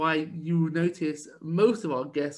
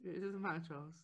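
muttered mock echo; 2 semitones higher, 17 dB quieter than usual. Mocking echo under breath.

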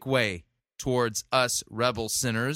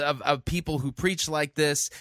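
normal talking voice

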